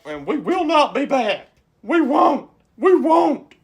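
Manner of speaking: very high, hammed-up parting vow; corny mock defiance. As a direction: imitate a buddy's overdramatic exit. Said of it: "did the corniest shit I've ever seen".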